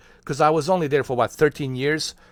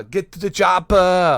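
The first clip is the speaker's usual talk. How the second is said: loud, drawn-out action-hero catchphrase; Arnold; 5 semitones higher, 7 dB louder than usual. Acted. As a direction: stretch it out like an accented movie commando. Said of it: "Has a Arnold and a chopper".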